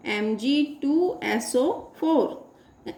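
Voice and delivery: very slow, each symbol set apart; slow dictation. Real, not acted. Teacher building a formula for students, slow dictation. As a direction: spell it out piece by piece.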